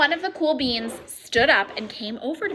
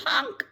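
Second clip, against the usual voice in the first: a goofy honking snort, pitched up; snorting honk laugh; 9 semitones higher than usual. A narrator's reading. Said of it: "I snorted when I laughed".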